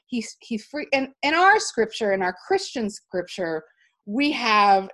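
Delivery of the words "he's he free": hushed whisper; whispering the freeing of slaves. Real, not acted. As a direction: whisper it with awe. Whispered aside about freeing slaves.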